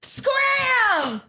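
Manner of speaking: very high shrieking yell; shout. Pretend. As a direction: scream them out the door. Shouted dismissal.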